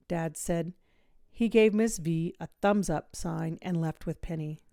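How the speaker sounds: ordinary delivery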